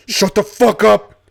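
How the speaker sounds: loud, high robber yell; mock yell. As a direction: yell at the hostages. Robbery role play.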